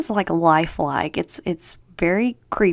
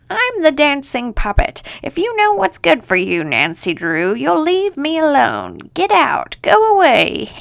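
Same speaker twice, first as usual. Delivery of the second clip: high, cackling witch voice rising to a louder cry; mock witch on the phone telling Nancy Drew to go away, climbing higher and louder; 9 semitones higher, 4 dB louder than usual. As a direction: play the creepy phone witch warning Nancy off. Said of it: "in a witch voice".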